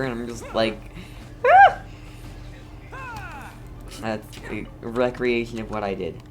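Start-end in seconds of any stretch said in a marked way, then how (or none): none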